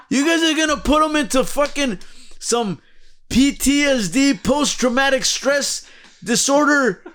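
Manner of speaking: a hint of an offended savior; outraged Jesus. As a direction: play Jesus fed up with the crosses. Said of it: "He's gonna be like".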